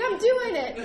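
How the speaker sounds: high, loud, laughing; laughing quote. Retold or imitated. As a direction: say it through a laugh. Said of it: "I was like".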